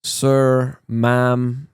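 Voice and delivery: drawn-out, level Filipino-style address; a hint of Filipino English; imitated Filipino courtesy. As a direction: lightly mimic Filipino polite address, holding each word. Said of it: "they always put that in there".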